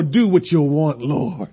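resigned surrender. Retold or imitated. Preacher surrendering to God, soft and resigned. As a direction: let it go quietly to God. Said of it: "That's when I didn't care anymore".

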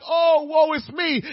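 slow, loud mock moan; mock self-pity. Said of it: "looking like".

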